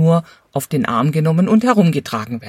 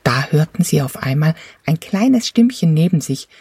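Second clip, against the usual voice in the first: usual speaking voice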